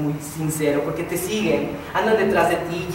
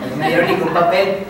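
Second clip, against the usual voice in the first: ordinary delivery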